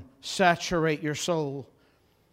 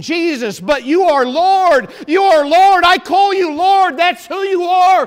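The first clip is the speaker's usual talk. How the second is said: high, shouted, emphatic declaration; insistent pleading; 13 semitones higher, 13 dB louder than usual. Acted. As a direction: cry it out as if dead set on being believed. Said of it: "They are insisting".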